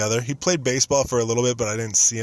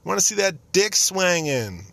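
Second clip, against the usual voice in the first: put-on high, slow, sing-song girly chant; mock cheerleaders; 10 semitones higher, slower than usual. Imagined cheerleaders chanting for Joe, high and slow. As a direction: ham up the cheering girls. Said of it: "cheerleaders that were like".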